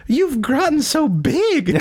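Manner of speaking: very high and slow, fond; Tracer voice. Retold or imitated. Tracer fondly telling grown Brigitte, very high and slow. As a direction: coo it slowly like an auntie. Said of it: "Tracer's like".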